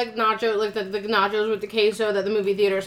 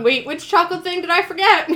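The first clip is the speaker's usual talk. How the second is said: higher, louder mock panic with a laugh; panicked; 6 semitones higher, 5 dB louder than usual. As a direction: blurt it like a sudden scare. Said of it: "panicking, going".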